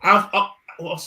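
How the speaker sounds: ordinary talk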